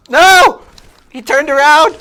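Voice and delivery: high, very loud cry of dismay; dismayed shout. Real, not acted. Player whose target turned around, real dismayed shout. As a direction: yell it out high as the plan fails. Failed sneak attack in game.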